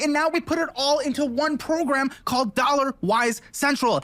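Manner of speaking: upbeat sponsor pitch, rising excitement; ad read. Acted. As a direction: sell it like a YouTube ad. Reads sponsor ad.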